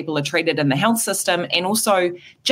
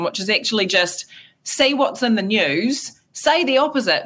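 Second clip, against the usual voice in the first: ordinary delivery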